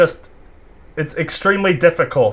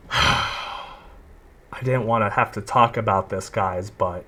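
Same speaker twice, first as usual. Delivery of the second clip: mock-reluctant apology, low and soft; sighing apology; 6 semitones lower, 6 dB quieter, slower than usual. Imitating a typical YouTube apology, sighing reluctance. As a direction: heave a fake sigh, then drag it out quietly. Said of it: "he just sighs. He's like".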